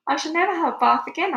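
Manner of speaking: high, loud and fast; excited boy. Acted. Boy gleefully tells his grandmother, high, loud and fast.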